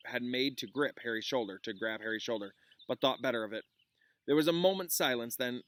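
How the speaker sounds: reading a novel aloud to a class; lively read-aloud, voicing the teacher's lines lightly; story narration. A narrator's reading.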